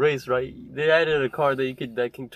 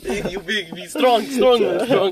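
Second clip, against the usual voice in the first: mock strongman voice; 5 semitones higher, 6 dB louder, slower than usual. Mock caveman, louder higher.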